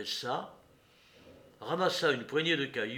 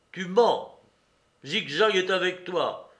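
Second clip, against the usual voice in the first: loud, higher blunt charge; angry accusation; 6 semitones higher, 9 dB louder, slower than usual. A narrator's reading. Courrapier accuses the woman of lying, angry. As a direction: call out the lie.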